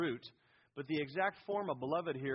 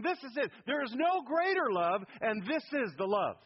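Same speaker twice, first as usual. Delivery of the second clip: high, loud peak on "no greater love"; emphatic declaration; 7 semitones higher, 5 dB louder than usual. Retold or imitated. Preacher voicing what the Father emphasizes to the Son, emphatic declaration. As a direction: proclaim it, driving up on "greater love". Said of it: "he's emphasizing".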